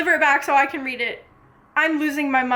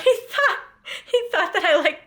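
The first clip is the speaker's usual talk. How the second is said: high, laughing through words; laughing at the memory; 6 semitones higher, faster than usual. Real, not acted. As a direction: giggle it out. Laughing retelling.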